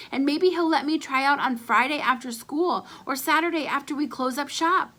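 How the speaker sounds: ordinary delivery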